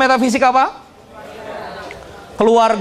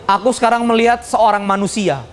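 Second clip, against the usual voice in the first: normal talking voice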